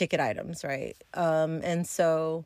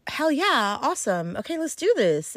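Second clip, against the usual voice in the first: gleeful, pitch jumping higher; excited; 6 semitones higher than usual. Retold or imitated. Narrator retelling her thrill at his purchase, excited. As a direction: cheer like the money just landed.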